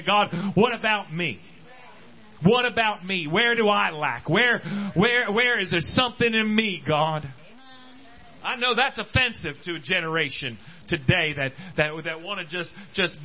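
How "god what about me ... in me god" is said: raised, searching pleas to God; pleading prayer. Acted. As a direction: press God with repeated questions. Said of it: "Look at yourself and say".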